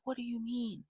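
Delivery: high-pitched mimicked girl's question. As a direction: put on a high girlish voice, asking.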